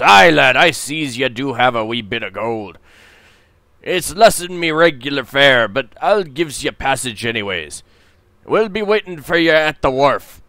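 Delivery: a tipsy sea captain sizing up a passenger; drunken pirate captain. Acted. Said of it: "the captain snatches it and says".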